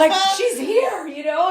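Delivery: laughing. Real, not acted. Laughing at herself.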